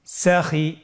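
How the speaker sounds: "সাখি" run together as one word; syllables joined. Shown as a demonstration. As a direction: blend both syllables of "সাখি" without a break. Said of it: "এভাবেও পড়তে পারেন". ("সাখি" একসাথে মিলিয়ে)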